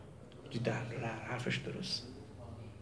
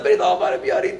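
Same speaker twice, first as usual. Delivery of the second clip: high, raised calling out; village headman yelling; 14 semitones higher than usual. Retold or imitated. Village headman calls villagers to bring the cleric back, high yelling. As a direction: shout for the cleric to be fetched back. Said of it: "کت خدا داد زد".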